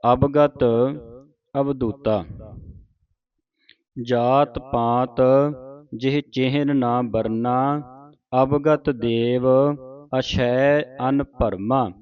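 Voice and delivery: slow, measured teaching recitation; scripture recitation. Shown as a demonstration. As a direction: draw each word out for students to follow. Reads scripture for students to follow.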